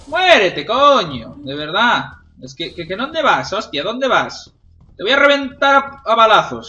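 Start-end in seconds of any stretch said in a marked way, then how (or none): 0.1-1.3 exasperated yell. Real, not acted. Yelling at an enemy. 5.0-6.7 high, loud threat; combat threat yell. Real, not acted. Gamer threatening to shoot enemies, heated yell.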